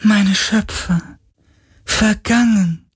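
high, slow spirit lament. A narrator's reading. Ghost mourns its vanished creators, slow high lament. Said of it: "klagte der Geist".

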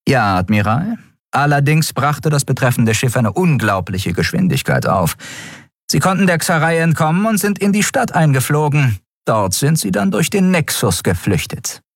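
high-voiced officer briskly reporting to the admiral; urgent, high report to the commanding officer; first officer reporting. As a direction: pitch her voice up as a crisp officer giving a report. Shia continues her report.

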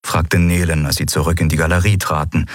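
plain reading voice

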